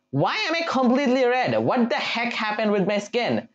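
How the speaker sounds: high, startled disbelief; can't believe his skin went red; shocked surprise. Real, not acted. Gamer finds his own hand red, shocked surprise.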